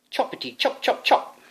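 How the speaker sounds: high, breathless chopping sounds; whispered chop sound effect; mock chopping. A narrator's reading. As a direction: chop through the thorns in a rush. Sword sound words.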